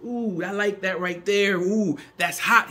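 lightly voiced God, pleased and savouring; a hint of God delighting in his creation; God admiring his work. God admiring the earth he molds, mildly voiced pleasure. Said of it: "he's probably said".

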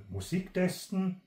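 ordinary delivery

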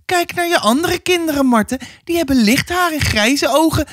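very high, pressing woman's voice; insistent neighbour woman. Acted. Neighbour woman argues her case. (indringende buurvrouw, hoge stem)

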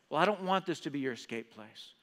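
normal talking voice

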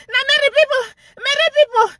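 high, cry-laughing wail through the words. Real, not acted. Laughing at the rival, a high, cry-laughing wail.

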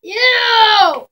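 loud high ew; disgusted shout. Real, not acted. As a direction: yell in disgust. Reacting to spat water.

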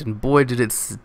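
normal talking voice

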